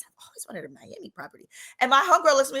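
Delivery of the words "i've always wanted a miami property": breathy wishful whisper; dreamy whisper. Retold or imitated. Fantasizing about his money, a dreamy whisper.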